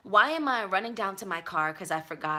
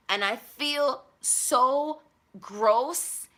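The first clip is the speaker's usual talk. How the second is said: drawn-out disgust; 6 semitones higher, 4 dB louder, much slower than usual. Real, not acted. Disgust at seeing him.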